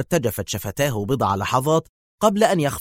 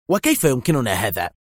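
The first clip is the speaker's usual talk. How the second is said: ordinary delivery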